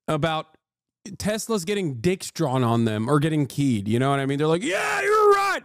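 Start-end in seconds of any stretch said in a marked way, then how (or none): 4.6-5.6 high-pitched mocking shout; hammed-up, soaring cheer; mock crowd cheer. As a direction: imitate an overexcited supporter yelling agreement.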